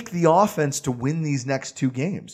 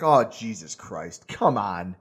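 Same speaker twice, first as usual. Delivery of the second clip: exasperated groan; 9 semitones lower, 5 dB quieter, slower than usual. Retold or imitated. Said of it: "it's like".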